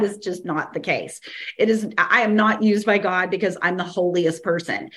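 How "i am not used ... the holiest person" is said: ordinary talk, nothing marked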